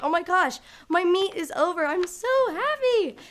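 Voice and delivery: a high, loud, happy gush as the meet ends; overjoyed. Retold or imitated. Meet finished, stuck vault.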